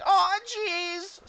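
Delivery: very high, loud goblin squeal; goblin mob voice. Acted. Goblins repeating their cry over the boom, high squeal. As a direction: squeal it like a whole chorus of goblins. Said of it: "just repeating".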